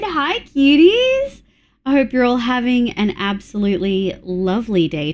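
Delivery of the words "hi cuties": high, slow sing-song greeting; cutesy coo. Real, not acted. Streamer cooing hello to her viewers, high and slow. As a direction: coo it out to the chat sweetly.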